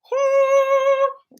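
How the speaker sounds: high sung whoo; a held high whoo as the eggs cook fast. Real, not acted. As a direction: let out a bright sung whoo.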